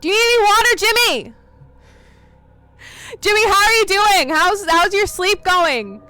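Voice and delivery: high, loud sing-song yell of a name; loud calling. Acted. Player yelling to wake Jimmy in the game, loud calling. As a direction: call across the house at full voice. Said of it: "because I'm gonna yell, and it's gonna be loud".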